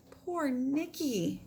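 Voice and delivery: a touch of grief over the dead pet; mournful. Acted. Paco seeing the dead cricket, mild mournful lament. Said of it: "cried Paco".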